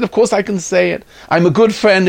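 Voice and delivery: indignant comeback, pitched high; retold shouting back. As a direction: argue back hotly, as in a retold quarrel. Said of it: "I screamed back".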